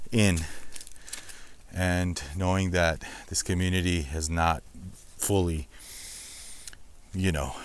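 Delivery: quiet, heavy-hearted; somber. Real, not acted. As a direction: speak low about the loss. Sad reflection on disaster area.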